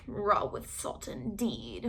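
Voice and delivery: low, soft, slow mutter. A narrator's reading. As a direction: grumble under your breath. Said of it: "muttered Doctor DeSoto".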